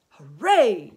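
loud, swooping hooray; shouted cheer. A narrator's reading. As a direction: cheer at full voice. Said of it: "shouted the old green grasshopper".

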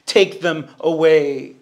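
slow, repeated plea, TAKE stressed; slow pleading prayer. Acted. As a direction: beg it twice, slowly. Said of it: "and so he prays".

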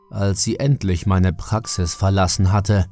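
plain reading voice

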